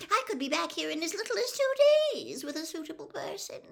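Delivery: very high, loud, rushed servant pleading; squeaky rising voice. A narrator's reading. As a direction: gabble squeakily to persuade your master. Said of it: "his voice rising squeakily".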